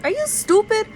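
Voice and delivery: high, loud "are you stupid"; exasperated yell. Retold or imitated. Retelling her reply to her cousin, a high exasperated yell. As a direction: snap it back at the cousin in disbelief. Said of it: "I was like".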